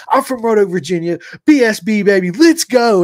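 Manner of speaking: shouting his hometown with swagger; hyped-up greeting. Retold or imitated. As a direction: announce yourself like a hype man. Retelling his hyped self-introduction.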